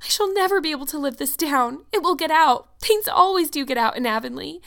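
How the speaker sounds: high-pitched, tearful outpouring; sobbing lament. A narrator's reading. Anne laments to Marilla that she is disgraced, sobbing. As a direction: pour out the disgrace in a high wail. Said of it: "sobbed Anne without looking up".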